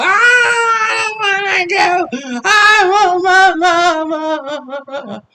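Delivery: high, drawn-out whiny wail; mock child crying. Boy kicking the seat, wailing slowly for his mama.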